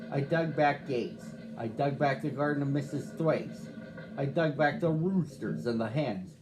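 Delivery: ordinary delivery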